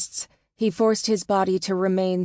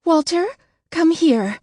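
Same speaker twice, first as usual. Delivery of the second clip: high, loud and urgent; urgent call; 11 semitones higher, 6 dB louder than usual. A narrator's reading. Woman calling her husband over to the baby, urgent and high. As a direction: call him over, excited.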